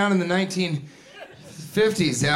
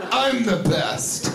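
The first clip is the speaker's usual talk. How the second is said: slow, higher-pitched boast; mock self-congratulation; 5 semitones higher, slower than usual. Joker admiring own joke, slow mock-smug boast. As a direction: drag it out, imitating a pleased joker.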